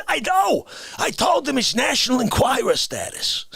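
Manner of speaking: ordinary talk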